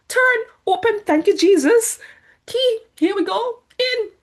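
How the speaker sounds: high, rising relief at the door; breathless thanks as the door opens; relieved excitement. Retold or imitated. Relieved getting through the door.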